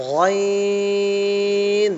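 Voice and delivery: গইন long, in a thick voice; thick ghain. Shown as a demonstration. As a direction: read গইন with a thicker, heavier sound. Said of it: "এটা হচ্ছে".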